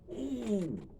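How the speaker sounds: high, drawn-out "ooh"; excited high-pitched sitcom squeal; Arnold Horshack. Acted. Tickled by the woman's accent, Horshack-style high ooh. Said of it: "Y'all remember Arnold Horshack".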